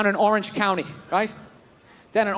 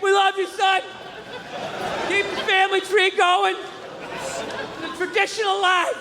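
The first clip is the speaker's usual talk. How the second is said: proud parent voice; 8 semitones higher than usual. Acted.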